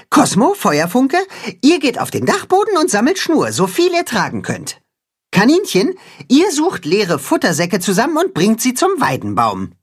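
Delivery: a lightly voiced, bossy cat-hen; commanding cat-hen. A narrator's reading. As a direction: hand out tasks briskly. Cat-hen gives orders.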